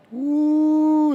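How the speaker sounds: high, loud joyful whoop; excited whoop. Real, not acted. Hyping up listeners about crying out to God, loud high whoop. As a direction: let out a sudden high woo.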